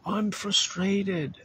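high, very slow whine; frustrated whine. Retold or imitated. Preacher voicing a frustrated person, high drawn-out whine. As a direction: stretch out the complaint in a high voice.

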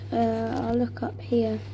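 ordinary delivery

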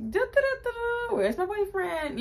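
high, idle humming singsong; mock sing-song. Girl mimics herself waiting for her boyfriend, mock singsong. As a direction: hum as if bored and waiting.

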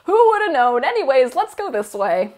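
high, loud faux-surprised voice; mock sarcastic. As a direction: ham up a sarcastic oh-wow. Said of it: "And Huachuan's like".

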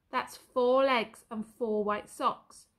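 drawn-out slow counting; slow deliberate. Acted. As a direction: savour each word slowly.

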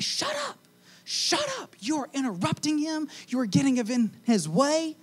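high, hissed shushing; hushing crowd. Acted. Crowd hushing the blind man.

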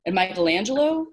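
quick, lifted suggestion; tentative. A narrator's reading. Boy adds another fighter to the list, tentatively. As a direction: offer another name, questioningly. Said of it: "Calvin suggested tentatively".

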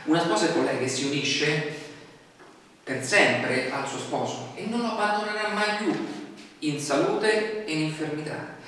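normal talking voice

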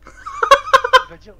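squealing with laughter; laughing. Real, not acted. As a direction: laugh through the words. Laughing at teammate's fright.

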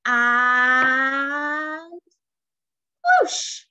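long rising 'and', then a high loud whoosh; building take-off. A narrator's reading. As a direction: stretch the suspense, then burst out. Broomstick takes off in story.

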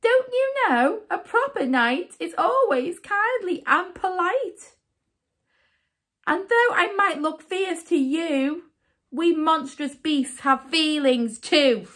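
high-pitched, storybook monster's reproach; lightly hammed-up offended beast; wounded monster. A narrator's reading. Monster gently scolds the young knight.

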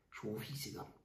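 breathy low whisper. Acted. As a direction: hiss it under your breath. Tense quiet exchange between rivals.

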